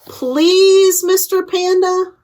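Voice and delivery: polite plea. Acted. Polite plea ending the story.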